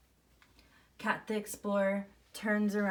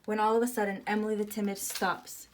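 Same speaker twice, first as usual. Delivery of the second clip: ordinary delivery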